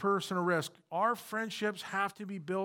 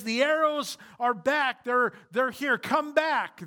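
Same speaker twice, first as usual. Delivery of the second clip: raised, higher call across a field; calling out the signal; 6 semitones higher, 5 dB louder than usual. Retold or imitated. Preacher voices Jonathan's signal call.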